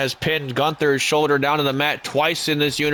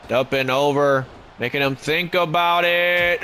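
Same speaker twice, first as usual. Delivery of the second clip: wrestling commentator. Acted.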